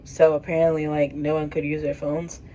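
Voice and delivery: ordinary delivery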